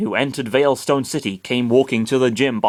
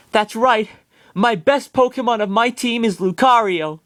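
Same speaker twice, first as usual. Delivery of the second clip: much higher, bright and certain; proud, high girlish reply; high Maylene voice; 10 semitones higher than usual. Acted.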